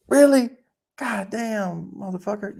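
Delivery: high, startled imitated exclamation; mock amazed outburst. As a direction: play a friend stunned by big news. Acted reaction to lottery win.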